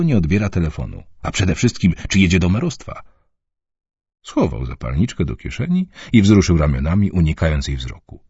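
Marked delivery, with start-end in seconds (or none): none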